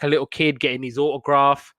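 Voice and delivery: ordinary talk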